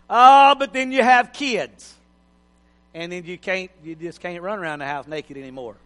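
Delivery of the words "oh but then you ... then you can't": ordinary talk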